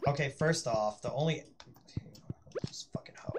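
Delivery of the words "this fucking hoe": whispered insult. Real, not acted. Muttered insult at game character.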